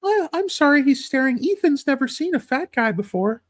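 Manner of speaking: high-pitched parody of a fussy mom; mock snooty mom. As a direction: squeak it out like an apologetic helicopter mom. Voicing a safari mom.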